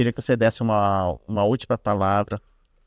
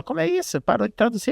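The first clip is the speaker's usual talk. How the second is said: high surprised retold question, much higher; incredulous question; 12 semitones higher than usual. Retelling the church translator stopping, higher and surprised. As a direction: pitch it up in mock disbelief.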